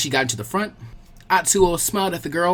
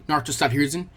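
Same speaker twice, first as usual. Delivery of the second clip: usual speaking voice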